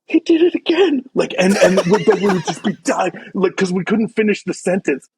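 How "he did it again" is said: very high, squeezed-out fragment; high mock-hysterical outburst; retold laughing fit. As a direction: imitate gasping it out through hysterics, pitched way up. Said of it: "we were laughing so hard".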